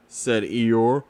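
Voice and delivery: usual speaking voice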